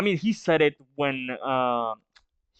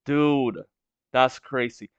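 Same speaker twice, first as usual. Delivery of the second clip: ordinary talk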